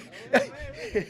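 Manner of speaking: sudden high laugh; burst of laughter. Real, not acted. Cyclist laughing at the board mid-comment, high burst of laughter. Laughs at his own joke.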